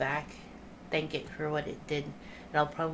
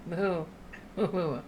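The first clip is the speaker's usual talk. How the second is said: lightly voiced moos; mock mooing. Player talking cow to the cow, light mock moos. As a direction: moo at the cow as if speaking its language. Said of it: "I'll go to the cow and go".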